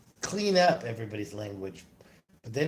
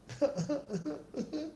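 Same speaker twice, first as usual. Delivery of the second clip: soft, high-pitched giggle; a quiet run of little laughs; chuckle; 13 semitones higher, 8 dB quieter than usual. Real, not acted. Chuckles to himself after "Nope.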